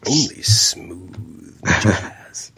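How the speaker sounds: slow whispered radio jingle; whispered DJ tagline. Pretend. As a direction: breathe it out like a late-night DJ. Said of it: "spinning the smooth jazz hits".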